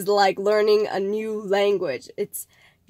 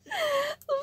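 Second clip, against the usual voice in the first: short high giggle; high laugh; 5 dB quieter than usual. Real, not acted. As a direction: giggle at yourself. Laughs at her own joke.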